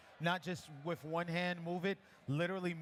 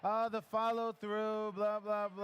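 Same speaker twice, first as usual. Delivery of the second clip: teasing, slower, higher imitation of a complaint; mock student objection; 5 semitones higher, 4 dB louder, slower than usual. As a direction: brush off the expected objection playfully. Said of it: "I know what you're about to say".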